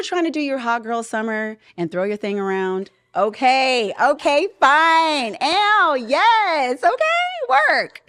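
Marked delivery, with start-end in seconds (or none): 3.2-8.0 loud, high sassy cheer, drawn out; mock hype. Mock hype for hot girl summer.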